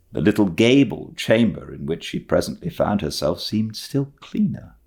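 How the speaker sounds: usual speaking voice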